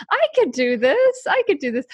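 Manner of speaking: high, chirpy quote of a past self; naive confidence. Retold or imitated. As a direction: imitate your overconfident self.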